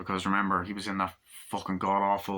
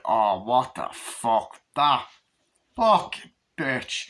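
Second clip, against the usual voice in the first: outraged reaction; 4 dB louder, much slower than usual. Retold or imitated. Reviewer voicing the audience's outrage, slow then yelled.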